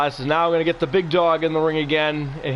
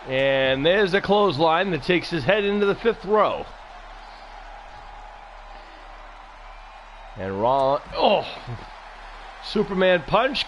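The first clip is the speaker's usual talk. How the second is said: wrestling commentator; 5 semitones higher than usual. Acted. Live wrestling play-by-play calls.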